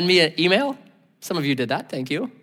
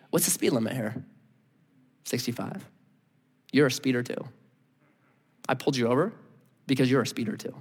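low, softer, flat verdict; lightly voiced stern policeman; calm stern cop; 3 semitones lower, 6 dB quieter, faster than usual. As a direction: state it like an unbothered officer. Policeman answers driver.

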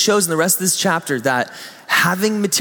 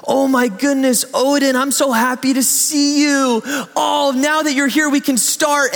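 higher, gushing welcome; delighted greeting; 8 semitones higher than usual. Retold or imitated. Volunteer welcoming a child by name, higher and delighted. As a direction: greet the kid like the best part of your week. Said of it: "And she'll go".

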